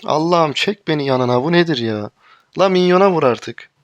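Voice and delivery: loud, pleading outburst; despairing shout. Real, not acted. Gamer begging teammate to hit minions, loud despair. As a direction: yell to the heavens, fed up.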